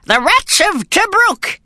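put-on high, slow old soldier boasting; proud. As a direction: puff up with old war pride. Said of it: "he said proudly".

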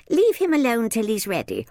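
put-on high-pitched protective girl; defiant child. A narrator's reading. Izzy shields Bert from the minder, defiant. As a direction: stand up for him in a high voice. Sister defends shy boy.

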